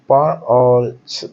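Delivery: पा and छ said very slowly, one by one; syllables spelled out. Shown as a demonstration. Teacher breaking the word into syllables for students. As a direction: separate पा and छ slowly. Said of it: "प में छोटा".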